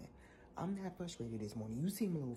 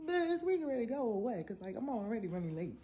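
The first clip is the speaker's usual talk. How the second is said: much higher, loud exasperated yell; exasperated outburst; 9 semitones higher, 8 dB louder than usual. Retold or imitated. Rider yelling at driver who won't move, high and loud. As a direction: blow up at him, high and loud. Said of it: "looking at him like".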